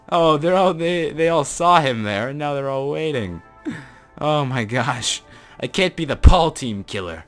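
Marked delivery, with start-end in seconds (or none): none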